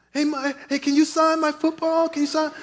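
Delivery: put-on high, small child's plea; little cute voice. Son asking the running back, cute little voice. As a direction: ask timidly like a shy little boy. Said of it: "a little cute voice".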